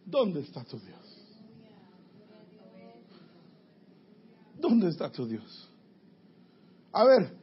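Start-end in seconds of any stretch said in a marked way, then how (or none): 0.0-5.7 mocking taunt. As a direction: hush it like a sneering question. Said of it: "me dicen todos los días".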